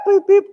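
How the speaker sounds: high, loud mimicked machine beeps; quick sing-out of electronic blips; scanner beeps. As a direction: beep like a barcode scanner. Imitates her barcode machine.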